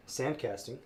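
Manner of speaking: ordinary talk, nothing marked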